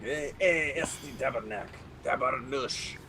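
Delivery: mock Québécois muttered swearing; lightly put-on French Canadian cursing; French Canadian accent. Joking back at the others, French Canadian accent.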